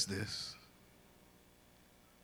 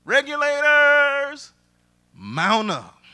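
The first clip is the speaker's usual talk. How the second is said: high, loud, drawn-out call to arms; rallying call; 12 semitones higher, 12 dB louder, much slower than usual. Acted. Preacher quoting [name] to the congregation, rallying call. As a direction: holler it long, like summoning a posse. Calls out [name]'s song line.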